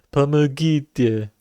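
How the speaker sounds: "Pomogite" read slowly in Russian; Russian word. Shown as a demonstration. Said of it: "which means "help me".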